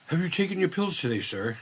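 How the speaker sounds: mock butler offering pills, gently; polite robot butler. Owner imagining his robot butler, a hint of polite robot. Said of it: "be like".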